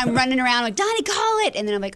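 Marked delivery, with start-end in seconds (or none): 0.8-1.5 high, urgent call across the house. Retold or imitated. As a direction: call out to Donnie. Calling to husband while searching.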